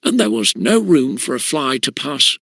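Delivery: usual speaking voice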